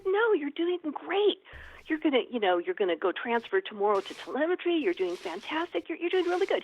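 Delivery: high-pitched, insistent encouragement; retold flustered reassuring; acted urgent reassurance. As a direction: hurry to reassure an upset patient. Nurse reassures distressed patient, acted.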